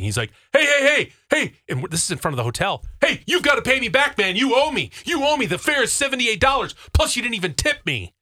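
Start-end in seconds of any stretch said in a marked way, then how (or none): 0.5-1.4 high, loud retold protest; acted-out driver's urgent hollering; indignant driver calling out. Driver protests short payment, retold. 3.0-8.1 high-pitched acted-out complaint; raised, agitated voice of a retold argument; indignant driver demanding payment. Driver demands full fare, retold.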